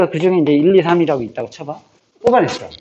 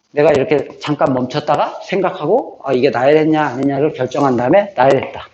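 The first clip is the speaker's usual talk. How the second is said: ordinary talk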